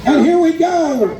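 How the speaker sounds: loud, high call to start the run; rally the crowd into it; hyping the crowd for the next dog; excited call. Real, not acted.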